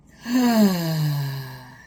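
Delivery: big loud sigh from the throat. Shown as a demonstration. Therapist showing a quick stress release, loud sigh. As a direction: heave out a long, loud sigh. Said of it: "sigh it out".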